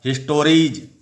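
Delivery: हिस्टोरीज ending in a clear ज sound; final s as j. Shown as a demonstration. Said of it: "तो जौ होगा".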